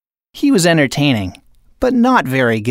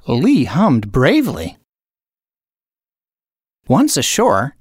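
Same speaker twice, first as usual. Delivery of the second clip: ordinary delivery, nothing marked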